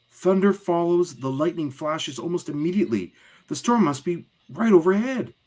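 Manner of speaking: put-on high-pitched child explaining; acted child's voice, high and urgent; know-it-all kid. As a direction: recite the facts in a high kid voice. Plays comic child explaining storm.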